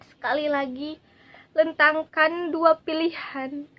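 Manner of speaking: emotional, declaiming patriotic verse; dramatic poem recitation. Acted. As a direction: recite with a breaking, heartfelt voice. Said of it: "saya akan membacakan puisi".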